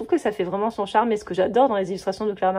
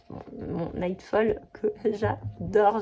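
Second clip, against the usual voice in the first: usual speaking voice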